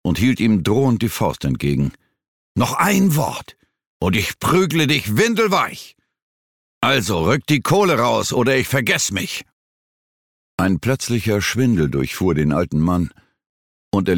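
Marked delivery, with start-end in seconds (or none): 2.6-9.5 threatening. A narrator's reading. Threatening with raised fist.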